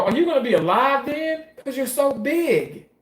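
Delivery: put-on young niece. Acts out young niece's blunt question.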